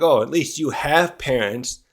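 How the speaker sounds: ordinary talk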